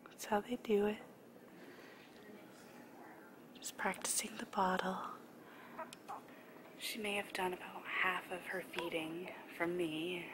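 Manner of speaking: soft hushed whisper. Real, not acted. Whispering beside the sleeping baby.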